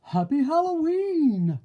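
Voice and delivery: big, loud booming welcome; bellowing wolf. Acted. Wolf host greeting party guests, booming. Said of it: "bellowed their wolf".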